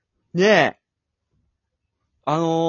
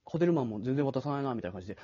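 ordinary talk

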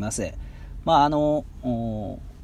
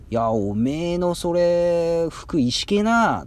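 higher, slow Ibaraki dialect teasing; Ibaraki dialect jab; 5 semitones higher, much slower than usual. Retold or imitated. A local friend mocking your outfit, in slow Ibaraki dialect. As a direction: drawl out the Ibaraki put-down about the clothes. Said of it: "言われたら".

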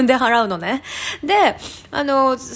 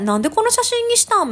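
mock puzzled question; 9 semitones higher than usual. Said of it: "うさんくさい営業マンみたいな".

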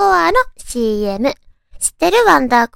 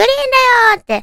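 excited catchphrase; 10 semitones higher, 4 dB louder than usual. Retold or imitated. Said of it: "いいんだよって言って".

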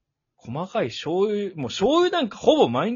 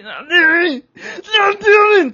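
high, loud comic retort yell; mock Kansai tsukkomi; 12 dB louder, much faster than usual. Imagined Kansai girlfriend jumping in every time, mock tsukkomi yell. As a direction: shout it like an over-eager comedy straight man. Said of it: "とかで来られても".

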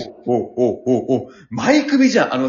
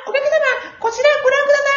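ordinary talk, nothing marked

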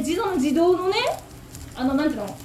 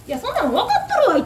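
irritated retort; 9 semitones higher, 3 dB louder, much faster than usual. Retold or imitated.

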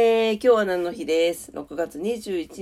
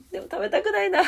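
laughing; 13 semitones higher, faster than usual. Real, not acted. Jokes about not wanting durian.

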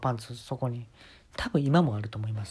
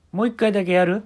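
loud offer; 7 semitones higher, 7 dB louder than usual. Retold or imitated. Jacob offers another try.

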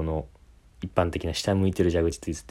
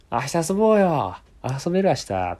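bright mock invite; 8 semitones higher than usual. 明るい友達の誘い.

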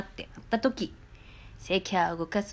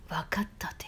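whispered Nagoya line; whispered quote; 3 semitones lower, 6 dB quieter than usual. Retold or imitated. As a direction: whisper わかったて. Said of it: "って言ってたけど".

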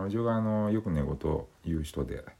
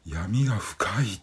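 breathy aghast whisper; 5 semitones higher, 5 dB louder, slower than usual. Real, not acted. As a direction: breathe it out in dismay.

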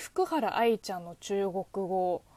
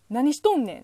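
"何しとんねん？" in Osaka dialect, louder and bantering; 3 semitones higher, 7 dB louder, faster than usual. Shown as a demonstration. Rendering the Dongbei line for Japanese viewers, louder Osaka-dialect retort. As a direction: toss off "何しとんねん？" like a Kansai comedy retort. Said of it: "大阪弁に近い".

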